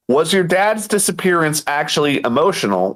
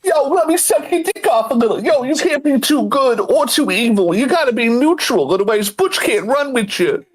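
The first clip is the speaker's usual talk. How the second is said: high-pitched gang tough talk; Butch; 8 semitones higher than usual. Acted. Gang member laying down rules to a recruit, high put-on voice.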